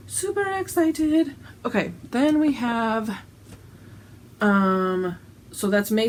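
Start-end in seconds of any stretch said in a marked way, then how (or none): 0.1-1.3 higher, slower gushing squeal; squealed excitement. Real, not acted. Telling viewers about the new shirt, squealing with delight.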